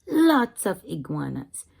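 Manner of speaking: ordinary delivery, nothing marked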